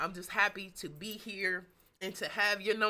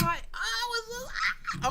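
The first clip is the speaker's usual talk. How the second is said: high, loud squeal of excitement; remembering the live stream, squealing with excitement; excited squeal; 8 dB louder, much slower than usual. Real, not acted. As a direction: let the excitement burst out as a squeal. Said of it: "I'm excited just now".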